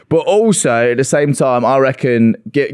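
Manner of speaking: usual speaking voice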